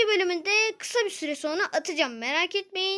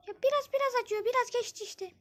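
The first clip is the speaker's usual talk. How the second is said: put-on girl voice; 7 semitones higher than usual. Zeynep says her leg still hurts.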